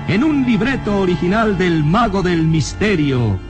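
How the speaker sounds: ordinary delivery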